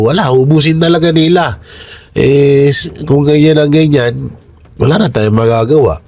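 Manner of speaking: talking through a blocked nose; stuffy, congested voice. Real, not acted. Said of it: "Pasensya na sa boses ko, ah. Baradong barado". (baradong ilong)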